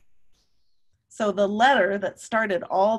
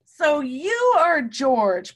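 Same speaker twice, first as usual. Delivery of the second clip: slow, raised greeting; 5 semitones higher, 5 dB louder, much slower than usual. Acted. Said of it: "Professor Wiseman said".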